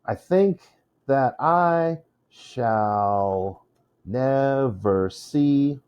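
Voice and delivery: "I think that I shall never see" drawn out very slowly, foot by foot; metered reading. Shown as a demonstration. Teacher showing poetic feet, slow measured scansion. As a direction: stretch each stressed syllable, pausing between feet. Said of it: "each poetic foot is two syllables".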